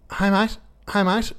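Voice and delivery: high-pitched mock New Zealand woman; strong New Zealand accent. New Zealand hostel worker waking a guest, strong accent. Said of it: "that real strong accent".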